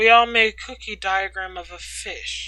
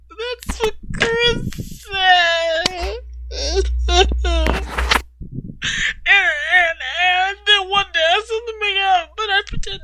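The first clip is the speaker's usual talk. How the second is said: crying; 8 semitones higher, 3 dB louder than usual. Acted. Katie confessing to Ryder, crying. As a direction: speak through crying.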